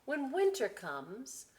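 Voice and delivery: put-on slow, lively read-aloud to children; storybook voice, slow and bright; story reading. A narrator's reading. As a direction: savour each line for the kids. Reading book.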